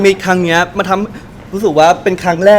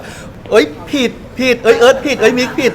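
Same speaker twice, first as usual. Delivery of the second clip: mock blaming; 5 semitones higher, slower than usual. Retelling blaming each other.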